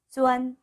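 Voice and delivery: "쭈안" high and level; pronunciation demo. Shown as a demonstration. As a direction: model "쭈안" as one high, even syllable. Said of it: "쭈안이라고 읽으시면 됩니다". ("쭈안"을 높고 평평하게 읽는 발음 시범)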